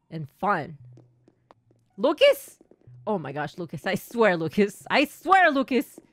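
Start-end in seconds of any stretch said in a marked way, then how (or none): 2.0-2.6 high, loud call across the room. Acted. Teacher calls a wandering pupil. 4.9-6.0 exasperated. Acted. Exasperated at Lucas running off.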